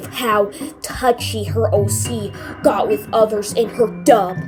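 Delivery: plain reading voice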